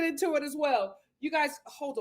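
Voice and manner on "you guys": ordinary talk, nothing marked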